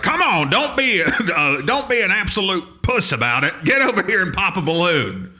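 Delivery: high, loud, taunting barker; crude barker boss. Retold or imitated. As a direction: shame the customer into playing. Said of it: "But he would do the same thing".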